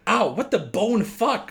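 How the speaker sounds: high, loud ow of pain; pained shout. Acted. Stabbed dad yelps and swears loudly. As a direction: yelp as the shiv goes in.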